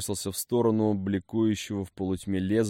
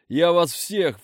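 higher shouted threat, stress on я; furious threat; 9 semitones higher, 6 dB louder, slower than usual. A narrator's reading. Chained hero threatens captors.

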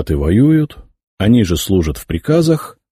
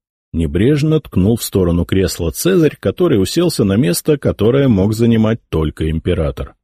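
usual speaking voice

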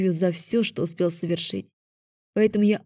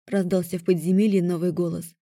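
ordinary delivery, nothing marked

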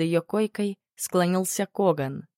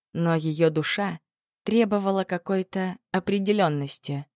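plain reading voice

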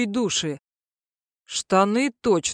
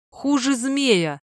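slow, drawn-out exhale; exhaled in slow disgust; 4 semitones higher, much slower than usual. Acted. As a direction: breathe the words out slowly. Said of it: "выдохнула мерзавка".

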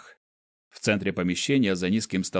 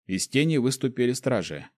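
usual speaking voice

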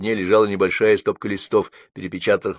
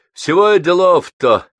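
repeated with concentration; 10 semitones higher, 10 dB louder, slower than usual. A narrator's reading. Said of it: "сосредоточенно повторил он".

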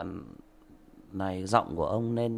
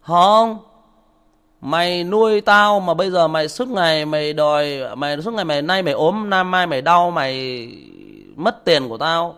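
loud, higher insulting tirade; child cursing back; 7 semitones higher, 10 dB louder than usual. Retold or imitated. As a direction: spit it at the father with scorn. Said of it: "Nó chửi lại".

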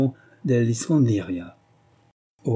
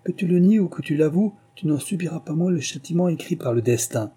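stern king; 5 semitones higher than usual. A narrator's reading. King sentencing the guilty dyer, stern raised verdict. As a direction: pronounce the sentence with anger.

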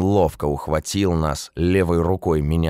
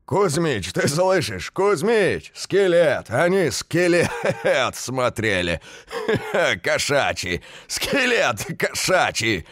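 booming laughter; 10 semitones higher than usual. A narrator's reading. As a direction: roar with laughter between the words. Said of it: "зычно загрохотал Петя".

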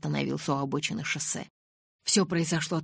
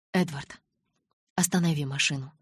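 usual speaking voice

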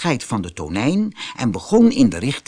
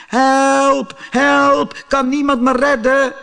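high, louder cries of despair; desperate scream for help; 13 semitones higher, 4 dB louder, slower than usual. Acted. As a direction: yell for rescue in the dark. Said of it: "begon te schreeuwen". (wanhopig en luid om hulp schreeuwen)

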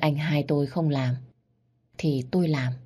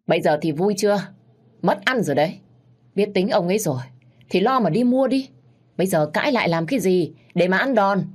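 loud, rising, sharp rebuke; mock her for bringing on the beating; scornful scolding; 4 semitones higher, 5 dB louder than usual. Younger sibling scolding her sister, loud and scornful.

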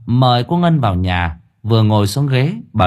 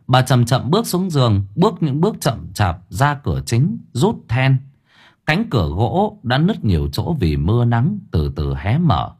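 usual speaking voice

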